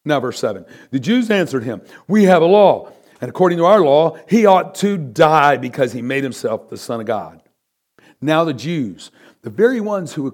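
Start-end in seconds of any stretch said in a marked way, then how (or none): 2.1-7.4 loud, high, forceful demand; insistent crowd. Acted. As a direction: hammer the law at Pilate.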